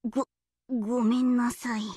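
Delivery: low, stammered sorry; chastened apology. Acted. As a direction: shrink into a small, low apology. Chastened after the imitation flops.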